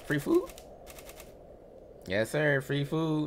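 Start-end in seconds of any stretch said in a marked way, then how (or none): 0.0-0.5 excited. Real, not acted. Repeats free food excitedly.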